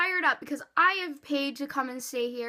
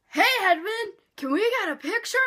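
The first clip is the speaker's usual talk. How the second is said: imitating a friend yelling out, higher and louder; mock fangirl shout; 7 semitones higher, 5 dB louder than usual. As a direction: call across the lobby like an overexcited fan. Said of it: "She screams his name".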